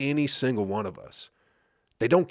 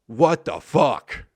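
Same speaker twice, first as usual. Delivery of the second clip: slow disbelief; 2 semitones higher, 5 dB louder, much slower than usual. Real, not acted. Exasperated rant about presidents.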